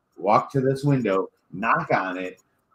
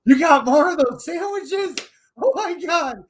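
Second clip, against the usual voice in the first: much higher, louder hammed-up drunk begging; drunk excitement; 3 dB louder than usual. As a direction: squeal for more sandwiches like a giddy drunk. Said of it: "We're like".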